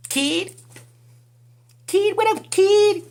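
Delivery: teasing what-up voice; mocking high greeting. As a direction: call out to him like a street buddy.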